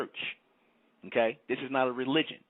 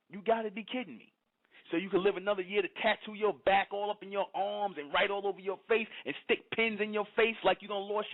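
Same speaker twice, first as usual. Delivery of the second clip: raised, high-pitched preacher's tirade; fiery preaching; 6 semitones higher than usual. Real, not acted. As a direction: preach it hard at the crowd, voice lifted high. Heated preaching at congregation.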